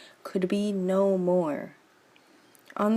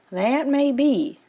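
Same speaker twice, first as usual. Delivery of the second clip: slow, higher doubtful crab friend; a wary best friend voice; Crabulet's voice; 7 semitones higher, slower than usual. Acted. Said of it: "replied Crabulet".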